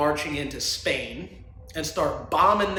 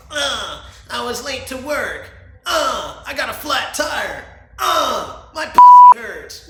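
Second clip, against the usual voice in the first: higher, whiny mock complaining, last line much louder; mock whiner; 8 semitones higher, 12 dB louder, slower than usual. Host mocking people who complain, whiny voice. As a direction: parody someone listing lame excuses.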